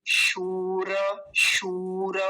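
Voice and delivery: शूर with the ऊ held long; long drawn ū. Shown as a demonstration. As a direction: stretch the ऊ in शूर slowly. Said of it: "दीर्घ ऊकार लंबा खींचेंगे". (शूर का दीर्घ ऊ लंबा खींचकर)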